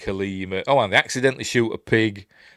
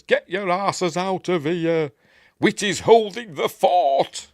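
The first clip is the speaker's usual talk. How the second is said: gruff order; 10 semitones higher, 5 dB louder, slower than usual. Retold or imitated. Whitty orders retreat.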